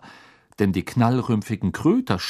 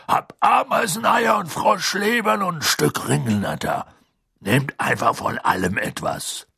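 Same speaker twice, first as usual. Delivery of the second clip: gruff Hagrid. A narrator's reading. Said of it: "Dann sagte er barsch".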